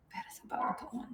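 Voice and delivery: breathy, voiceless read-out; whispered reading. Real, not acted. Vlogger reading the campaign to herself in a whisper. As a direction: whisper it to yourself.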